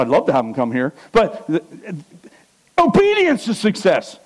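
usual speaking voice

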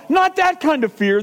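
ordinary talk

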